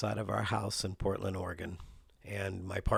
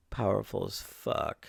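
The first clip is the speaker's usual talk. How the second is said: ordinary talk, nothing marked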